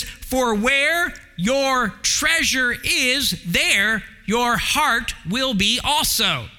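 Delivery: slow, weighty reading of the verse; preacher quoting Jesus, slow and weighty; slow scripture quote. Acted. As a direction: let each word land. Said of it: "He says".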